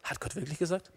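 very soft, innocent-sounding question; just asking. Acted. Devil just asking about God's word, hushed and casual. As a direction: slip the doubt in quietly, as if only asking. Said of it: "Ich frage ja nur".

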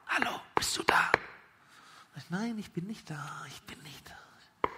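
urgent midnight whisper through a door. Acted. Friend whispering at the locked door at midnight, urgent whisper. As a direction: hiss it so the house stays asleep.

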